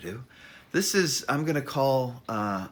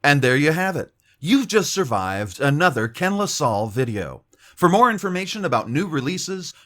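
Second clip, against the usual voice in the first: loud, higher, upbeat announcer sign-off; big promo voice wrapping up the video; announcer outro; 7 semitones higher, 10 dB louder than usual. Acted. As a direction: sell the channel like a radio host.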